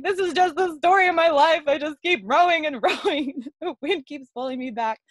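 retold wail of frustration, high and loud; anguished lament. Woman to herself in the rowboat, anguished lament. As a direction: re-enact an overwrought cry, pitched high. Voicing her tearful inner lament.

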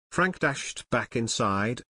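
usual speaking voice

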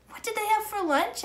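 put-on high, eager little girl. A narrator's reading. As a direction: ask it like a curious young girl. Annie's curious question, high child voice.

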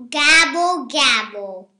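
loud turkey gabble, falling; a grumpy turkey sound; grumbling gabble. A narrator's reading. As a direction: gobble it crossly. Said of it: "grumbled Turkey".

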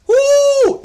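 high, loud excited woo; excited whoop. Real, not acted. Fan hyping his team's healthy stars, a high excited woo. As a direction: let out a hype whoop.

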